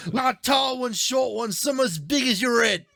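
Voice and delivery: very high cartoon British voice; high-pitched hammed-up British; mock British accent. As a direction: squeak it out like a cartoon Brit. Playing a cartoon British character.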